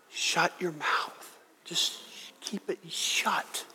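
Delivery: whispered, slow and secret; slow conspiratorial whisper; hushed whisper. Pretend. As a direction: breathe it like a private warning. Spirit's quiet advice.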